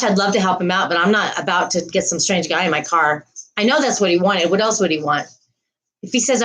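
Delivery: normal talking voice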